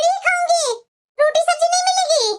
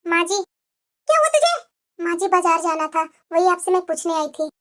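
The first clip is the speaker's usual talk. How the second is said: lower, timid voice of a downtrodden wife; meek elder daughter-in-law; 7 semitones lower than usual. Acted. Elder bahu to younger sister-in-law, meek and resigned. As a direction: sigh it out like a bullied bahu.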